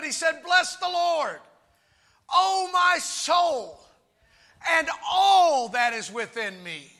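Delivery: full-voiced, emphatic preacher; fervent preaching. Real, not acted. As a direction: drive each phrase home to the congregation.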